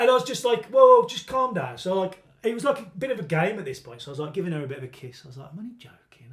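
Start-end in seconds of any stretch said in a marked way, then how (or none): 0.7-1.8 loud, slow, higher plea to calm down; calming someone down. Retold or imitated. Calming a girl waving a knife. 5.5-6.3 a breathy whisper, close in; whispered reassurance. Retold or imitated. Softening it during a kiss.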